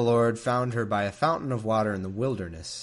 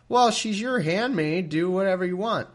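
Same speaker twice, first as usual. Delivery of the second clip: dismissive Abram; 8 semitones higher, 5 dB louder than usual. Retold or imitated. Abram telling Sarai to handle the maid, dismissive. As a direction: brush it off like a lazy husband. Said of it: "And Abram says".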